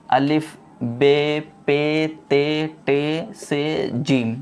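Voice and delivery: each letter drawn out slowly; slow letter drill. Shown as a demonstration. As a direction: spell them out slowly for the learner. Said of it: "मैं आपको एक मरतबा और पढ़ के बताता हूँ". (धीरे-धीरे अक्षर पढ़ना)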